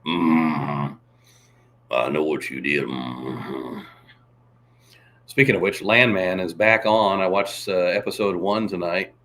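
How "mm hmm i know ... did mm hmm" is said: very low, grunting mm-hmm; slow low rural drawl; Sling Blade voice, much lower; Sling Blade impression. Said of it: "I can do Sling Blade".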